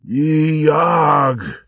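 high, sharp dying shriek; death scream. A narrator's reading. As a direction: scream as the blade strikes. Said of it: "Scarbod's last scream".

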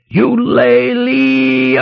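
high, long drawn-out battle cry; war cry. A narrator's reading. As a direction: stretch the cry out high, as a last charge. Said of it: "With one last war cry".

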